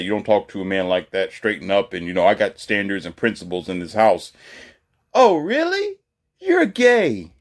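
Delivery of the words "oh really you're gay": very high, loud mocking woman's voice. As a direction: squeal it like a scornful woman. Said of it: "And she say".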